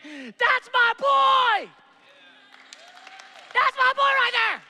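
booming proud shout, high and slow, drawn out; God calling out proudly over his son; voice from heaven. Acted. Proud father shouting from heaven over his son. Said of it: "a voice comes from heaven saying".

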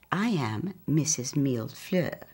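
ordinary delivery, nothing marked

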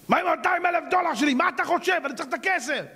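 high, pushy creditor voice; demanding creditor. Acted. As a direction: badger him for the money. Creditor demands his money.

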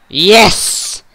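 loud, high victory shout; triumphant cheer. Real, not acted. Gamer celebrating the final win, triumphant cheer. Winning the game.

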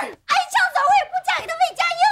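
fast, high shouted refusal; rapid-fire defiant cry; defiant outburst. Acted. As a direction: hurl back a desperate refusal. Daughter refuses despite death threat.

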